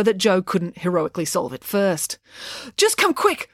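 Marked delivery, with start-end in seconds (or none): 2.8-3.5 very high, urgent plea; acted frantic girl, pitched right up; pleading. A narrator's reading. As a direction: plead in a breathless panic. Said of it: "pleaded Loretta".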